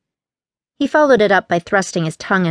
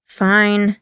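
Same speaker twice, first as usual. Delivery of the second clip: tight. Acted. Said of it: "he replied tightly".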